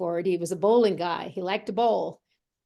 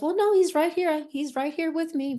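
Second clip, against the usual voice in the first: surprised reply; 8 semitones higher than usual. Retold or imitated. Sister answers, surprised by question.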